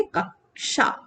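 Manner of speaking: कक्षा split as "kak... sha"; correct pronunciation. Shown as a demonstration. Teacher answering her own question, कक्षा as "kak-sha". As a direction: pause on the k, then release "sha". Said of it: "इसका pronunciation हम क्या करेंगे?".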